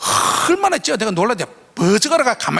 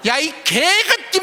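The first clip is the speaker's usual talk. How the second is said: high, slow swearing yell; cursing shout; 8 semitones higher, slower than usual. Retold or imitated. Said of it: "막 하면서".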